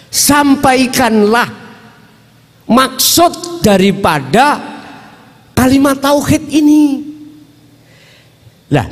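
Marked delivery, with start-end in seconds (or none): 0.1-7.1 stretched words pitched high, as a decree; proclaimed divine command. Acted. Voices God's command to Muhammad.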